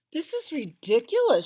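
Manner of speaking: high, loud wail of dismay. A narrator's reading. Rosie wailing that her rose is gone. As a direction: wail it out, near tears. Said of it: "she wailed".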